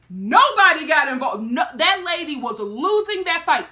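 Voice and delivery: loud, emphatic declaration. Real, not acted. Storyteller insisting onlookers stayed out, loud and emphatic. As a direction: hammer the point home loudly. First, heated take of her point.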